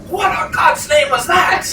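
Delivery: startled high-pitched cry; Cornelius. Acted. Game master as villager hearing a scream, startled high cry. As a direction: exclaim in high-voiced alarm. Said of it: "Cornelius goes".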